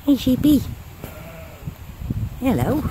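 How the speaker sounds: high sing-song greeting; calling to sheep. Real, not acted. Walker greeting a sheep by the river, high cooing call. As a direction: coo a high hello to an animal.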